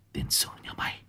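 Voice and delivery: whispered curse. Acted. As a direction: hiss the curse under the breath. Cursing the thief under breath. (ông chửi thầm kẻ trộm, thì thầm)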